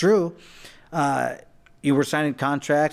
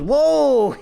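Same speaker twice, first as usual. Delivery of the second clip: high, loud startled whoa from King; mock jolt of surprise, pitched way up; 10 semitones higher, 7 dB louder than usual. King startled as the attorney grabs him, high loud whoa.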